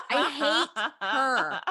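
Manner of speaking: I hate her, drawn out through laughter; half laughing, half whining the hate; playful exaggerated loathing, laughing; laughing mock hatred. Real, not acted.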